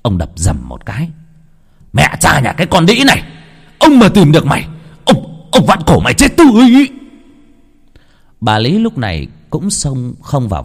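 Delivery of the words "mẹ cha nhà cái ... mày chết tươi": high, loud enraged bellow building; furious shout. Acted. Angry husband yelling threats at the runaway maid, furious shout. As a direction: spit out the threat at full volume.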